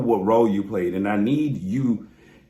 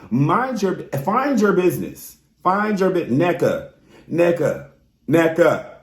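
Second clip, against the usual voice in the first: higher and louder, calling her name out sharply; sassy raised-voice telling-off; mock scolding call-out; 7 semitones higher, 4 dB louder than usual. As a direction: call Nneka out like a fed-up auntie.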